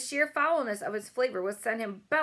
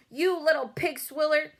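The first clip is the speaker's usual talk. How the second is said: louder, higher giant's insult; angry roar; 7 semitones higher, 5 dB louder than usual. A narrator's reading. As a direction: bellow it in rage. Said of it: "roared the blood bottler".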